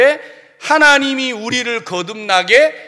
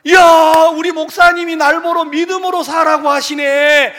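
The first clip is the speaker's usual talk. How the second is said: high, loud overjoyed cry of praise; delighted believer; 7 semitones higher, 8 dB louder than usual. Retold or imitated. Congregant overjoyed at the pastor's words, loud happy praise. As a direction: gush with joy like a thrilled churchgoer. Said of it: "이렇게 되면 그 말이 좋겠죠".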